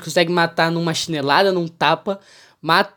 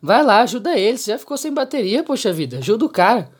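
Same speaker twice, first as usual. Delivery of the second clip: esposa insistindo brava; 6 semitones higher, 4 dB louder, faster than usual. Retold or imitated.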